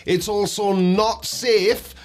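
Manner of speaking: ordinary delivery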